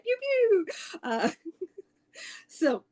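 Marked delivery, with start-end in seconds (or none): 0.0-0.7 high, playful lightsaber noises; lightsaber pew pew. Pretend. Channeling Jedi for the group, mock lightsaber pew pew. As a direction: zap like a toy blaster, pitched way up. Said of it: "I'm just channeling Jedi".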